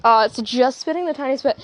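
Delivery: ordinary talk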